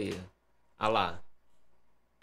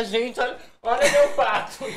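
voz aguda e animada, mostrando o pato; imitando a namorada; 7 semitones higher than usual. Retold or imitated. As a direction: imitate an excited girlfriend showing off a pet duck. (imitando a namorada empolgada com o pato)

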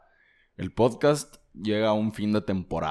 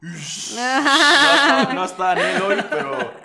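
laughing; 12 semitones higher, 6 dB louder than usual. Real, not acted. Laughing reaction to radio demo.

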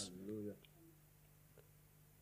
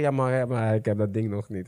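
low, soft muttered complaint; mock grumbling; 9 semitones lower, 7 dB quieter than usual. Preacher voices a believer still waiting for the thing, grumbling. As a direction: sink into a half-swallowed grumble. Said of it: "Maar dan ga je zeggen".

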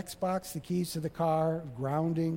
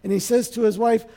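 usual speaking voice

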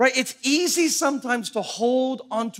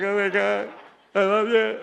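imitated tearful gratitude; 2 semitones higher than usual. Tally thanking the boss for shoes, mock crying. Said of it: "Tally starts crying".

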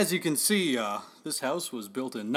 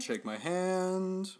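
slow eerie; 5 semitones higher, 5 dB quieter, much slower than usual. Acted. Agent repeats softer, slower.

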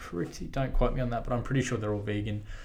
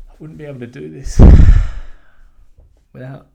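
emotional, choked up; 11 dB louder than usual. Real, not acted. Runner thanking his support group, tearful. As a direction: let the gratitude break through tears. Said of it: "I just got a bit emotional there".

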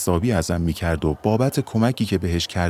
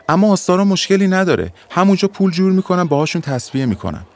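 higher, louder, urgent explaining; pleading young man; 9 semitones higher, 5 dB louder than usual. Acted. Young man to clerk, anxious higher pleading. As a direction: justify yourself, pressed and insistent.

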